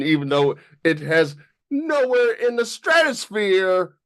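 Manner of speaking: NOWHERE in the STRATOSPHERE pushed high; "stratosphere" stretched high for emphasis; emphatic exaggeration. Real, not acted. Exaggerated denial of the likeness.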